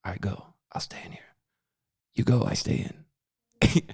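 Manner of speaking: hiding in a bush, whispering to a buddy; unvoiced urgent whisper, slowing at the end. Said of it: "I'm like".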